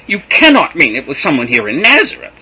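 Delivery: shouting accusation; angry disbelief. A narrator's reading. Joseph still shouting.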